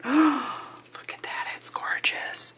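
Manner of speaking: gasp, then slow awed whisper; hushed wonder at the glitter. Real, not acted. Awed whisper over glitter tape.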